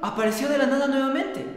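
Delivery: usual speaking voice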